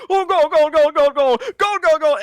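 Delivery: very high, very loud goal scream; goal call. Retold or imitated. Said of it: "He's like".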